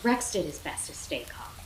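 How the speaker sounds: ordinary delivery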